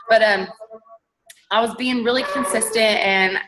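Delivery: usual speaking voice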